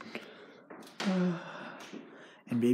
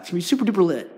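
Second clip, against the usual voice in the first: hyped up; 5 semitones higher, 8 dB louder, much faster than usual. Real, not acted. Hyping up the vlog.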